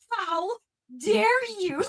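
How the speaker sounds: high, rising mock outrage; outraged at the author for the death. Real, not acted. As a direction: scold the book, high and indignant. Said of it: "outrage at character death".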